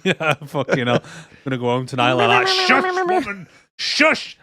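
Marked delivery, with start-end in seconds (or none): 2.7-4.4 high, sharp shush; hammed-up hushing outburst; mock shushing. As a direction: silence her in mock exasperation. Mock silencing of partner at home.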